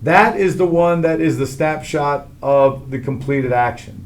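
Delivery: ordinary talk, nothing marked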